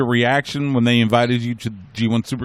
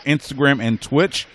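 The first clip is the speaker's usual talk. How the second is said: ordinary talk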